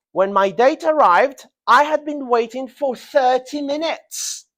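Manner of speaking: high, loud complaint about a long wait; complaining. Shown as a demonstration. Teacher acting out waiting for a late date, complaining. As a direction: complain loudly, pitched well up, leaning on "thirty minutes". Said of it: "Normally when you're complaining".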